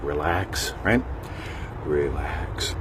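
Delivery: low, soft, slow calming voice; a soft hypnotic murmur; soothing relax. Shown as a demonstration. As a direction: ease them into relaxing. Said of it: "Get into that state of".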